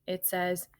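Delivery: plain reading voice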